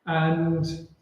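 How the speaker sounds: usual speaking voice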